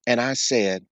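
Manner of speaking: ordinary talk, nothing marked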